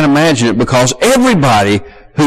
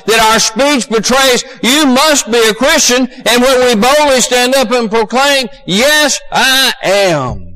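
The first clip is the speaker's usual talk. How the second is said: high, sustained pulpit proclamation; fervent high-pitched sermon delivery; impassioned preaching; 9 semitones higher than usual. Real, not acted. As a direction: proclaim it over the congregation, building to the end. Preaching at full force.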